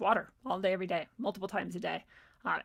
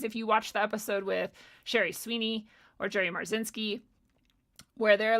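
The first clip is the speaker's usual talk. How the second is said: ordinary delivery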